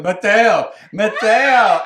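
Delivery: loud, laughing mock yell of a name; chasing the cab, call his name; calling after him.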